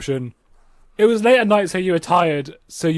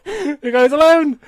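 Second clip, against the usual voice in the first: laughing; 11 semitones higher, 7 dB louder, slower than usual. Real, not acted. Laughing at the story.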